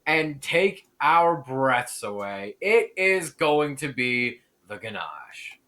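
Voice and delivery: slow, drawn-out reveal; dramatic reveal. Acted. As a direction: stretch it out to build suspense. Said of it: "let me do something for dramatic effect".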